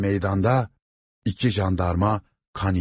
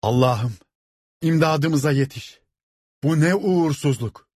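high-pitched wailing plea to God; crying out; 8 semitones higher than usual. A narrator's reading. Woman by the roadside begs God, crying out. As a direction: cry out for help in despair. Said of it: "kollarını havaya kaldırmış bağırıyordu".

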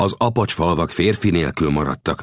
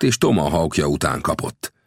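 ordinary delivery

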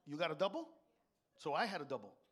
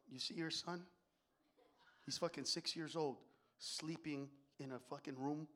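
hushed wife; 5 semitones lower, 12 dB quieter than usual. Pretend. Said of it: "She'll walk into one of our bedrooms and go".

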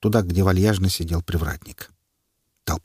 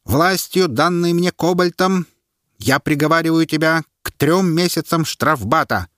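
high, louder formal sentence; pronouncing sentence; 8 semitones higher, 3 dB louder than usual. A narrator's reading. Herald sentencing the prisoner before the crowd, formal. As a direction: declare the sentence with authority.

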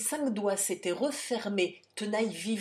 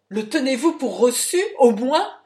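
much louder, higher, outraged yell; howled challenge; 6 semitones higher, 15 dB louder than usual. A narrator's reading. The insulted count screams at Jean, outraged yell. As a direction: bellow the demand at him. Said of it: "hurla l'insulteur".

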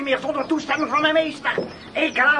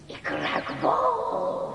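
sniffing witch; 13 semitones lower, 8 dB quieter, much slower than usual. Acted.

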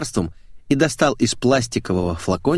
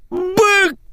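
very high, explosive yell; 5 dB louder than usual. Acted. Boss losing his temper at employee, yelling. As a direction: burst out in a screaming roar. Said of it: "заорал Сергей".

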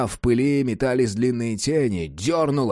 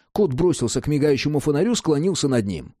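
ordinary delivery

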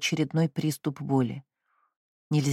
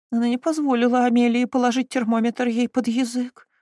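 moaning; 8 semitones higher, 4 dB louder than usual. Acted. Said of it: "простонала кузина Стиклс".

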